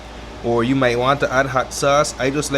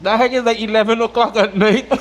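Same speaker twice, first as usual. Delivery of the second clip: laughing; 9 semitones higher than usual. Real, not acted. Laughing, high.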